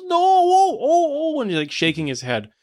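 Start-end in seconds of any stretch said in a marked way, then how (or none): 0.0-1.4 long, high, loud wail; hammed-up agonised howl; Santa's wailing no. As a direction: drag out a howling "no" as the tortured victim. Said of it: "And Santa goes".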